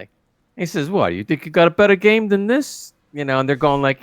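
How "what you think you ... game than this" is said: gangster voice. Retold or imitated. Gangster challenge retold.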